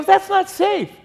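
very high, mock-panicked protest; alarmed protest. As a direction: object in exaggerated alarm. Retold argument in the boat.

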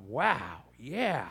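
low, hushed realization; retold private moment of wonder; quietly awed. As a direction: let it sink in, low and soft. His thought on reading the quote.

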